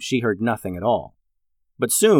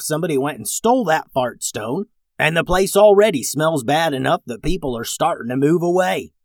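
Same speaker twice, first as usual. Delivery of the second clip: plain reading voice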